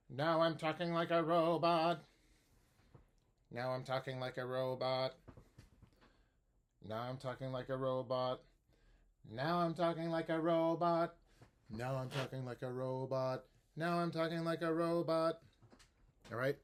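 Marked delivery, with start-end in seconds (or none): 0.1-2.0 higher, louder monotone robot; clipped even robot speech. Shown as a demonstration. Teacher speaks like a robot for mimicry. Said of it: "I'll start talking like a robot". 3.5-15.4 even, evenly timed robot phrase. Shown as a demonstration. Teacher repeats robot line for student. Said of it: "I'll start talking like a robot".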